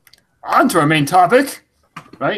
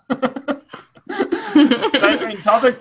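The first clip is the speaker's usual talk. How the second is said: laughing; 6 semitones higher, much slower than usual. Real, not acted. Laughing at the flop.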